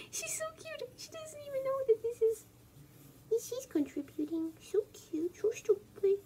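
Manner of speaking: high, squeaky gushing; cooing over a pet. Real, not acted. Gushing over the cat that wandered in, high cooing. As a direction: gush helplessly in a tiny high voice.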